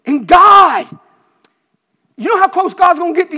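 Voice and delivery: full-force preaching. Acted. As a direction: preach the verse out at full power. Preaching swells again.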